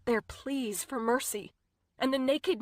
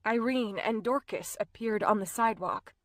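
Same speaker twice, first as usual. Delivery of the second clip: usual speaking voice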